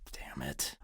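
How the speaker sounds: hissed under the breath; whispered curse. Acted.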